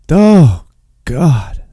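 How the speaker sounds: exasperated outburst on the first word; irritated. Real, not acted. Fumbling with things on screen, exasperated. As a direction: groan it out, really annoyed. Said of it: "they're so irritating".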